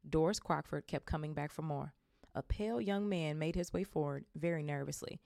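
plain reading voice

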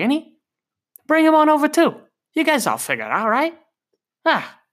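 put-on high-pitched, worked-up Boston accent. Advises Brady as a Bostonian.

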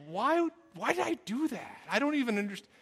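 high baffled self-questioning; bewildered inner voice. Retold or imitated. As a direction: squeak it out in disbelief. Bewildered thought while running.

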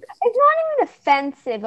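usual speaking voice

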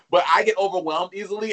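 ordinary talk, nothing marked

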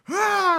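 cri aigu et fort d'un joueur fâché; cri de rage imité. Retold or imitated. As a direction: hurle ta frustration comme au badminton. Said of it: "il est comme". (cri de colère imité)

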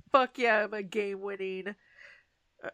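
loud hammed-up bragging; mock triumphant cheer. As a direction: cheer yourself on like a winner, tongue in cheek. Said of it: "be like".

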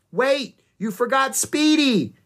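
high, loud, drawn-out call; shouting. A narrator's reading. Annie shouts after rangers driving off, urgent call. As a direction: call after someone who is leaving. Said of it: "Annie shouted".

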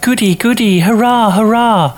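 very high cheering kiddie voice; squeaky little children's hurrah; children shouting. A narrator's reading. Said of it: "tiny children near and far shout".

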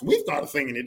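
ordinary delivery

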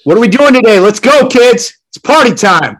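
loud, high, put-on morning cheer; hyped-up dad. Dad showing up for his kids despite inner darkness, hyped-up. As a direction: belt it out to rally the kids.

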